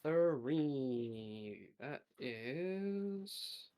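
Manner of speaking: normal talking voice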